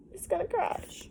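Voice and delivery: high, soft alarmed cry of worry. Real, not acted. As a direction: sound worried for the ship, pitched well up. Worried about the ship.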